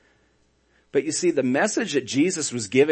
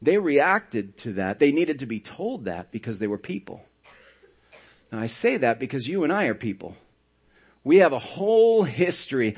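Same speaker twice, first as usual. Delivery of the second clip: ordinary talk, nothing marked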